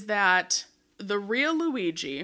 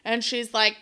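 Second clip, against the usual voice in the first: normal talking voice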